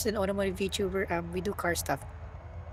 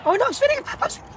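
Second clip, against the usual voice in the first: panicked. Real, not acted. Streamer spinning out behind the Mustang, panicked. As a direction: cry out in a real panic.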